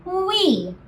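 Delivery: high little wee; a light swoop up on wee; playful wee. Shown as a demonstration. Teacher guiding kids' pencil dive, soft high wee.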